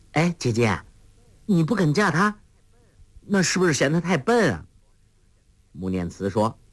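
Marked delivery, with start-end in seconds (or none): 0.1-4.7 teasing smile. Acted. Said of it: "黄蓉笑着问".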